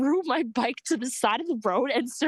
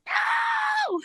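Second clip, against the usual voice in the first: despairing shout. Retold or imitated. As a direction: wail the no out long. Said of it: "I was like".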